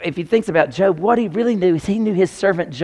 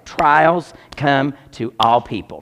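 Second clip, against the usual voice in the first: slow, deliberate, word by word; slow dictation; slower than usual. Real, not acted. Preacher dictating a point to write down, slow dictation. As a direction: dictate it slowly for note-takers. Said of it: "I want you to write this down".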